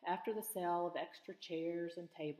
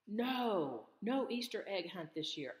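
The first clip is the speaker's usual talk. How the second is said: frowning refusal; 4 semitones higher, 6 dB louder than usual. A narrator's reading. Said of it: "Seth frowned".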